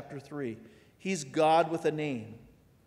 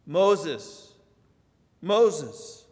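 raised, calling voice from the fire; 3 semitones higher, 6 dB louder than usual. Acted. God calls to Moses from the bush, raised call. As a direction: call his name across the distance.

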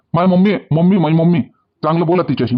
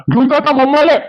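angry shout; 7 semitones higher than usual. Acted. Father orders suitor's mother away.

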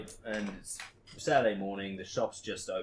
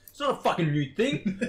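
high, loud indignant snap; retold outburst; 10 semitones higher, 8 dB louder than usual. To the coworkers who claim it's new, loud indignant. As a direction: protest it like it's obvious. Arguing with coworkers over scrapers.

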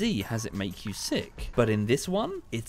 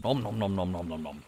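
nom nom chomping; 4 semitones lower, faster than usual. Shown as a demonstration. Dinosaur drinking from stream.

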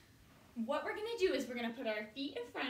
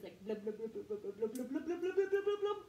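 bubble noises; 5 semitones lower, faster than usual. Shown as a demonstration. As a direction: blow bubbles like a jellyfish. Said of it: "make some good bubble noises".

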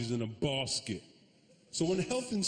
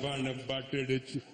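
just a little Indian accent; measured mock-Indian ordering; slight Indian accent. Shown as a demonstration. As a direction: put on a light Indian accent. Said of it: "Just a little bit".

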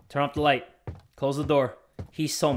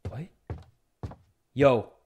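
ordinary talk, nothing marked

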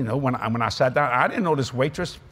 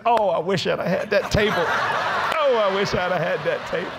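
envious waiters; 3 semitones higher than usual. Retold or imitated. Envious waiters voiced in the story.

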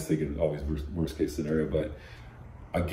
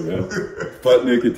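usual speaking voice

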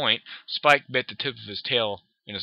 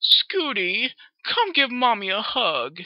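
high, slow sugary mom voice; cooing mother; 11 semitones higher, 4 dB louder, slower than usual. Acted. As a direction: coo it like a doting mother. Mother coos at daughter.

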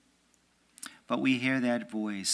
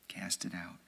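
much softer, lower repeat; soft echo; 4 semitones lower, 10 dB quieter than usual. Shown as a demonstration. Preacher repeating the command to the faithful, hushed. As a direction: let it fade to a hush. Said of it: "repeated command".

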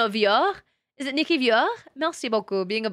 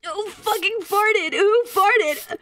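high, strained demand while gagging; revolted, high-pitched and stretched out; disgusted outrage; 8 semitones higher, much slower than usual. Real, not acted. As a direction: demand the culprit through disgust.

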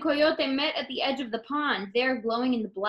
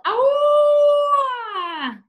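much higher, loud sustained howl; long coyote howl; 9 dB louder than usual. Acted. Coyote howling at the moon, long and loud. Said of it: "and howled".

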